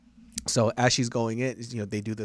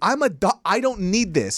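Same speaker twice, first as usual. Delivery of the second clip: much higher, louder exasperation; fed-up yell; 10 semitones higher, 8 dB louder, slower than usual. Retold or imitated. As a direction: snap that you don't need this. Doctor fed up.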